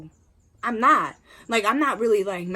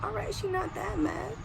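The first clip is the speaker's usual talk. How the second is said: relieved thought; 11 semitones higher, 4 dB quieter than usual. Retold or imitated. Relieved thought after mom chuckles.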